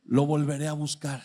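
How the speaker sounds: mucho más grave y fuerte; voz de borracho. Retold or imitated. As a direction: imita al borracho decidido a volver. Borracho al pasársele la cruda. (borracho recuperado anuncia que vuelve a buscar, grave y fuerte)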